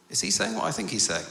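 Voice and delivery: quick, high-pitched disbelief; incredulous reader. Acted. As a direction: ask it as a scandalised double take. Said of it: "bits where you're thinking".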